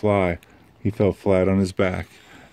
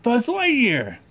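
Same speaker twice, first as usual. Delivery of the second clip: put-on much higher excited kid cry; gleeful yell; slower than usual. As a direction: crow it with glee. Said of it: "yelled Sid".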